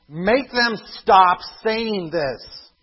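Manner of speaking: high, loud, very slow demand; loud indignant demand. Acted. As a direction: push the order out slowly, outraged. Quoted Pharisee demand, loud take.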